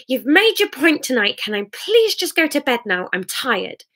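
tired sobbing fury, fading at the end; tearful anger. Acted. Sarah crying at Hamish, bitter and worn out. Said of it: "Her crying had escalated to swift, panicked sobs".

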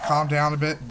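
ordinary delivery

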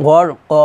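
"গড় ক" with the last vowel drawn out; "ক" lengthened, no final n; one-alif stretch. Shown as a demonstration. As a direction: hold the open "ক" long. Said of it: "এক আলিফ টান দিবেন".

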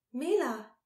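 high, loud cry of surprise; startled exclamation. A narrator's reading. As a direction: call out the name in alarm. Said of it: "exclaimed Malin".

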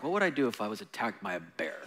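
low, quick, far-off musing, a touch dazed; husband voiced lightly, absorbed in a daydream; lost-in-thought husband. In the meme, husband answering his wife, lightly voiced and distracted. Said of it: "and the husband's like".

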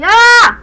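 sudden high loud yelp; startled yelp. Real, not acted. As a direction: jolt out a sharp yelp. Sudden death in game.

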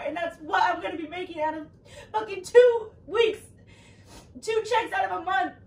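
loud, high, tearful yelling; angry shouting. Real, not acted. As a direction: shout out the stress, voice near cracking. Heated money argument, shouting.

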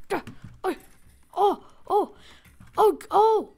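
usual speaking voice

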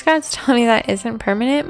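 usual speaking voice

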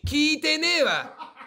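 loud, high, drawn-out comeback; mock-indignant retort. Streamer rebuffing a viewer's unasked confession, loud and indignant. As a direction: stretch out a loud comic objection.

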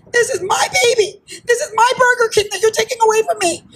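high, wailing indignant protest; insulted. Retold or imitated. Manager learning her TV station has been sold, insulted and wailing. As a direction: plead as if your baby is being taken. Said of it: "I was just insulted".

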